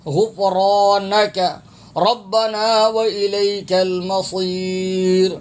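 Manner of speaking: slow, drawn-out teaching recitation; Quran recitation. Shown as a demonstration. Teacher modelling a verse for learners, slow Quran recitation. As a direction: hold each long vowel on a steady chanted note.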